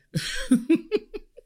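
high-pitched laugh after confessing; real laughter. Real, not acted. Server amused at having binned the card, high laughing. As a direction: crack up high at what you did.

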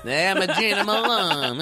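high, slow put-on character voice; mock introduction. Host pretends to be the friend, high drawn-out put-on voice. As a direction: stretch the name out like a silly character. Playing with the name Gina Malone.

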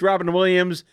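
usual speaking voice

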